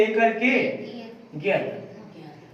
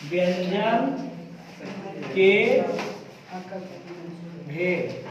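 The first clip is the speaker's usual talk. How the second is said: word by word with long pauses; slow dictation; 4 semitones lower, 6 dB quieter, slower than usual. Real, not acted. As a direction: dictate it slowly, leaving time to write. Teacher dictates heading to write. (रुक-रुक कर इमला बोलना)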